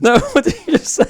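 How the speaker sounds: fast, high laughing question. Real, not acted. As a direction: laugh while asking what they said. Laughing, demanding a repeat.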